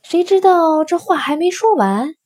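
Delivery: ordinary delivery, nothing marked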